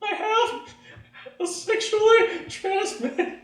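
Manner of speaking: put-on high, halting teenage confession; squeaky, drawn-out confession; slow repeat. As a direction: say it slowly, word by word, up high. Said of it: "but slower".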